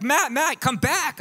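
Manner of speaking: high, loud mock call down the street; urgent high-pitched impression, calling him back; imitated calling after someone. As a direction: call out high, imitating a woman giving chase. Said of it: "she comes chasing me down the block".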